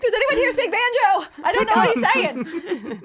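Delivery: high, loud mock panic down a hall; hammed-up retold yelling; panicked yell. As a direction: holler for help, half laughing. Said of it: "I panicked and I yelled, like, down the hall".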